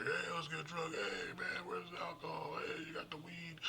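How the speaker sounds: hammed-up stoner looking for the party; carefree partier. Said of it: "they're tripping".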